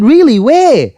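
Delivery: loud, high-pitched mock amazement; feigned surprise. Driver told the car is found, feigning surprise. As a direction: overdo the innocent astonishment, pitch shooting up.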